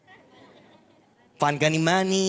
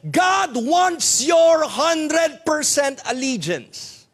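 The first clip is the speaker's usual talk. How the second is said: preaching shout; 6 semitones higher, much slower than usual. Real, not acted. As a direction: proclaim it at full force. Preaching climax on commitment.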